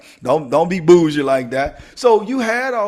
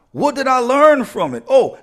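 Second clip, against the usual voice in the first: normal talking voice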